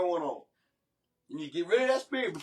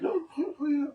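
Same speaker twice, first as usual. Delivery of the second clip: high-pitched mimicry of a wife; mocking wife's tone; 9 semitones higher than usual. Husband showing how his wife said it, mocking her tone. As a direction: imitate her in a high, exaggerated voice. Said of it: "Can I show you how she said in that tone".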